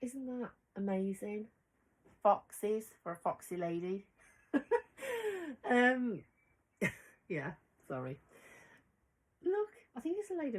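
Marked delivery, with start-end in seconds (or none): 4.5-5.6 a high bright burst of laughter; real high laugh. Real, not acted. Laughing at her own foxy lady joke to viewers, high and bright.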